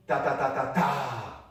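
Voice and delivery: five loud tapped ta syllables; teacher setting a rhythm to copy; rhythm demo. Shown as a demonstration. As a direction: tap out the pattern evenly. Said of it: "So if we do this".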